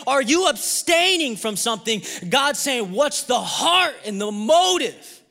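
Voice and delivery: high, loud, driving sermon; full-force preaching. Real, not acted. Preacher tells congregation God wants the heart, forceful. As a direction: build each phrase with punched key words.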